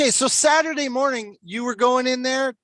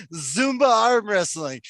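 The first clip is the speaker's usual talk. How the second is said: ordinary delivery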